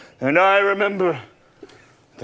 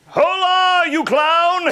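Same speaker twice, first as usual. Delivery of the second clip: calling out; 8 semitones higher, slower than usual. Acted. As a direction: call out to someone far off. Calling out to a shepherd.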